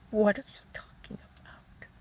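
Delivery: soft, nearly whispered reply; hushed retold question. Wife questioning accusing husband, retold in a near whisper. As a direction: re-enact it under the breath.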